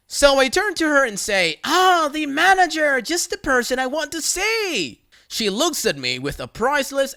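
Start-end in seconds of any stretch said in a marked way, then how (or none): 1.6-5.0 mock cheerful greeting. Narrator mock greets Karen.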